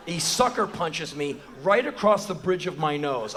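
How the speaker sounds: ordinary talk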